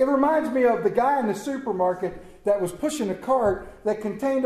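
ordinary talk

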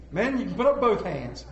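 usual speaking voice

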